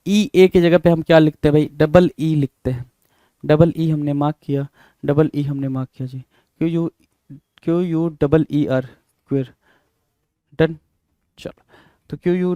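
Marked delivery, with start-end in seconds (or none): none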